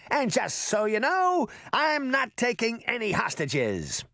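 high-pitched boastful call; shouting. A narrator's reading. Trickster boy warns the girls from his flying horse, shouting. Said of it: "Puck shouted to the girls".

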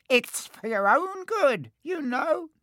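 high falsely saintly poltergeist. A narrator's reading. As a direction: play innocent with sly glee. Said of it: "in a saintly voice".

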